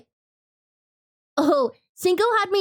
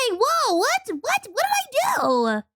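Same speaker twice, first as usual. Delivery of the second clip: high startled what; surprised; 10 semitones higher, slower than usual. Real, not acted. Startled by something on stream.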